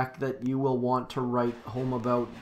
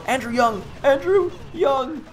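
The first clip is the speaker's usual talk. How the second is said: excited call; 11 semitones higher, 7 dB louder than usual. Real, not acted. Calling out a viewer's name.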